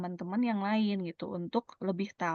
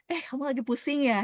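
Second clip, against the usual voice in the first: quick, higher, caring question; acted concerned friend; 6 semitones higher, 3 dB louder, faster than usual. Asking a friend who looks unwell, concerned. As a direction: ask with sudden concern.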